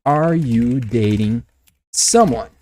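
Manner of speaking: slow, word by word, pause before "someone"; measured teaching pace, each word separate; slow model question. Shown as a demonstration. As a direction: spell out the question slowly for learners. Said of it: "a pergunta direta, indiscreta, seria".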